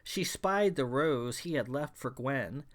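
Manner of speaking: ordinary delivery, nothing marked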